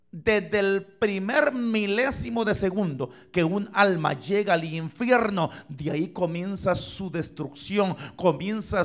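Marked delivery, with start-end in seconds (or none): none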